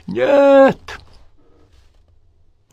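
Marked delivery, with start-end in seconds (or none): 0.1-1.0 piercing, very high and loud yell; dying patient yelling as the doctor sends Pendergast out; yell with all his might. A narrator's reading. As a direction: bellow it with everything left. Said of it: "заорал, что есть мочи".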